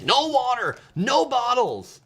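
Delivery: high, drawn-out imitation of an officer's order; hammed-up official refusal; mock TSA agent. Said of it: "the T S A people be like".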